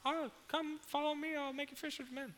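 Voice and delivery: high, faint voice calling from far away; distant call. Retold or imitated. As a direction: sound like Jesus calling from down the shore.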